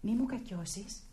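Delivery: soft, hushed coaxing; gentle coaxing. Acted. Nora softly coaxes Christina to tell the truth. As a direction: soothe her so she opens up.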